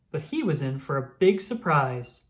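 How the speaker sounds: ordinary delivery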